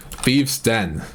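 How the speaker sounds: normal talking voice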